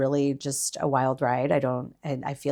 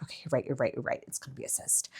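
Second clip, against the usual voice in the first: calming herself; 12 dB quieter, much faster than usual. Retold or imitated. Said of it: "I'm like".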